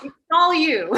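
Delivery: laughing through the words; half-laughing at her own failure. Real, not acted. Laughing punchline about bombing.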